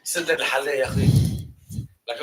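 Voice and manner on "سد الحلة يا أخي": quick, loud Moroccan dialect; Moroccan Arabic. Shown as a demonstration. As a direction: fire off a phrase like a Moroccan. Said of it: "Moroccan, they speak like this".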